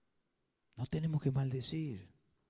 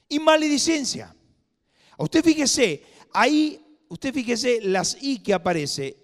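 much higher, louder emphatic preaching; preaching at full force; 10 semitones higher, 9 dB louder than usual. Acted. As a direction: hammer the point home. Preacher raising his voice.

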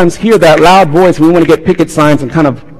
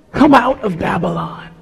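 soft, high, tender pleading; hushed last appeal; tearful plea; 5 semitones higher, 6 dB quieter than usual. Acted. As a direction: plead lovingly, close to tears. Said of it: "with tears in his voice".

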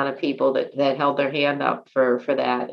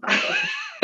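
high laugh; 6 dB quieter than usual. Real, not acted. Laughs at her own answer.

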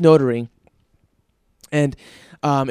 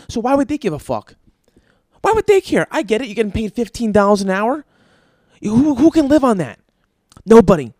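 worked-up rant; 6 semitones higher, 4 dB louder than usual. Real, not acted. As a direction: pitch climbing much higher and louder. Heated rant about wages.

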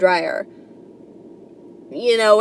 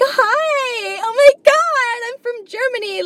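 squealing, much higher, gushing hello; mock cheery tourist; 13 semitones higher than usual. Airbnb guest arriving in New York, gushing mock squeal. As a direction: gush like an overeager tourist meeting her host. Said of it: "they come all happy".

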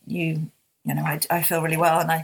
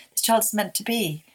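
normal talking voice

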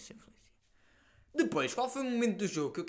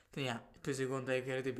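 ordinary delivery

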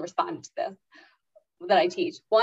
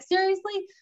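higher mock exasperation; exasperated disbelief; 12 semitones higher than usual. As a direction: roll your eyes at it. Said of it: "I'm like".

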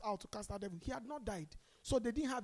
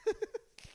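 laugh; 10 semitones higher than usual. Real, not acted. Preacher laughing at own joke.

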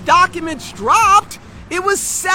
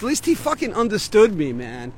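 ordinary talk, nothing marked

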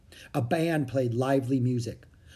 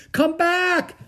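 high, loud shout after someone; desperate calling; 7 dB louder than usual. A narrator's reading. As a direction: call after a departing boat. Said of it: "Jack called".

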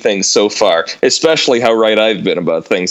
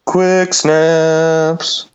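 high sing-song show name, drawn out; little girl ghost voice; 5 semitones higher than usual. Pretend. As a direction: sing it like a creepy ghost child. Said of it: "like a little girl ghost sing-songy voice".

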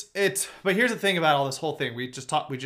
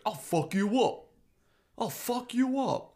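higher, slow put-on tough-guy voice; hammed-up slow threat, pitched high; mock Mad Bum threat; 8 semitones higher, slower than usual. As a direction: drawl it out like a hothead pitcher threatening the ump. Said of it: "he's like".

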